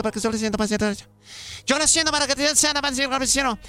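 breathless mock incantation, words blurring together; gabbled comic cosmic chant; rapid-fire ritual dialect. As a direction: rattle off a garbled spell without pausing. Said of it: "unas palabras rapidísimo en un dialecto".

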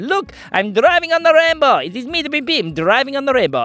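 high, gleeful exclamation; excited boasting. Acted. Pim Pim showing off to Brinley, excited high voice. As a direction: crow it high with delight. Boasts while driving on rainbow.

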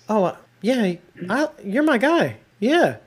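high-pitched, placating pal voice; suddenly chummy, pitched well up; mock buddy-buddy. Acts Pope suddenly buddying up to Carver.

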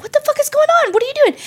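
very high-pitched, startled demand; acted-out alarm, voice shooting way up; alarmed bewilderment. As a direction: demand to know, high and freaked out. Startled friend pulled into bathroom.

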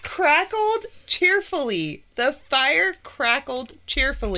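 slow slurred effortful reading; over-careful tipsy reading. Real, not acted. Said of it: "why can't I ... get that shit out".

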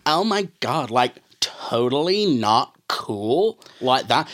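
lightly imitated Valley Girl drawl, slow; a hint of a ditzy Valley Girl voice; Valley Girl accent. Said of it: "idiot Valley Girl accent".

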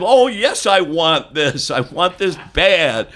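high, loud and slow, savoring each word; hammed-up greed. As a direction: ham up the craving for the jerky.

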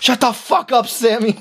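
high-pitched mock family yelling; Adam Sandler skit character. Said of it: "that Adam Sandler skit".

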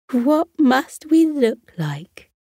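high, slow giggling question; giggly. A narrator's reading. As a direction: laugh through the words. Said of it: "Bree giggled".

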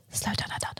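whispered calmly, imitating the instructor; instructor's whisper. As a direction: whisper it like a nervous driving instructor. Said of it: "Why is he whispering to slow down?".

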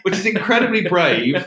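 high, loud laugh through the words. Real, not acted. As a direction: burst out laughing mid-sentence. Laughing at own story.

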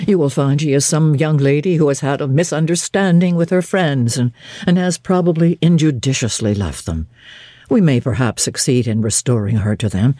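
put-on stern young clergyman judging a stranger, curt and low; terse clergyman. A narrator's reading. St. John voiced by narrator.

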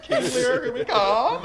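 high, laughing grand announcement; mock triumph. Mocking herself for writing a novel, laughing mock triumph. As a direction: declare it like a pompous overachiever. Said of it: "And I was like".

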